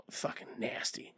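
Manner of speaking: hushed, voiceless and disgusted; disgusted whisper. Real, not acted. To co-host about the filthy bear attack, a disgusted whisper. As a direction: whisper it, grossed out. Grossed out by unsanitary bear wound.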